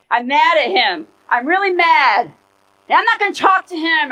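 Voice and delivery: loud, high venting, stretching out the words; acted anger. Wife venting about her husband in an example, hammed-up mad. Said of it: "and I'm pissed".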